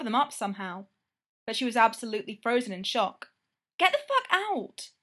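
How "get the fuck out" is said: loud, high angry snap. Acted. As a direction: snap it at the intruder. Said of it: "Greta snapped".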